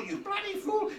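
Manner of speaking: a budgie shrieking curses from its cage. Retold or imitated. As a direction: squawk it like an angry budgie. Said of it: "it would be shrieking".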